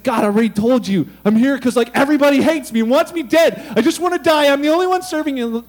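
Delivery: loud, worked-up plea of grievance; loud protest. Retold or imitated. Elijah protests loudly to God.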